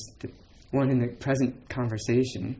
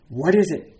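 louder, higher question; 5 semitones higher, 8 dB louder than usual. Retold or imitated. Bai Zhang asks the turned-around monks in a loud voice. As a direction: call it out to the crowd. Teacher's question after the shout.

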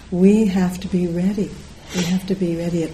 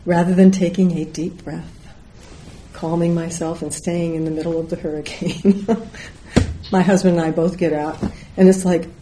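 normal talking voice

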